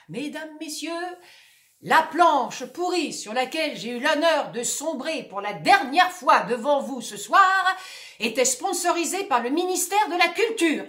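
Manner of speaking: loud announcer voice, partly sung; ad announcement. Acted. Sinking actor making an ad announcement to the public. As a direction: pitch the sponsor like a showman. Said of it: "Il fait une annonce publicitaire".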